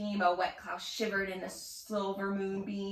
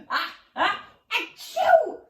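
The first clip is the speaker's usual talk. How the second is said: building ah-ah into a loud high achoo; big sneeze; 10 semitones higher, 10 dB louder, slower than usual. A narrator's reading. Bigfoot sneezing away the fog, a thunderous sneeze. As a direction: let a huge sneeze explode. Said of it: "A sneeze thundered forth".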